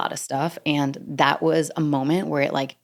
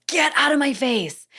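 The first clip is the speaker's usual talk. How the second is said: yelled; 10 semitones higher than usual. Retold or imitated. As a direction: blow up at them, much higher pitch. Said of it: "I just yelled in the middle".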